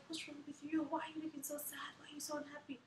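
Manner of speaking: high, soft questioning, lightly acted; imitated concerned midwife. Midwife asking the sad mother what's wrong, gently concerned. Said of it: "she was like".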